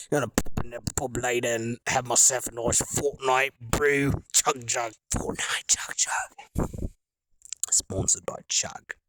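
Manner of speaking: jokey Cockney, sinking to a low rasping whisper; Cockney accent. Pretend. Streamer plugging a Fortnite brew at the pub, mock Cockney accent. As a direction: play a Cockney pub-goer, trailing into whispered chanting. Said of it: "Cockney accent".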